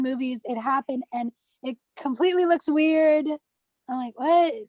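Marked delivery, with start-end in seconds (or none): none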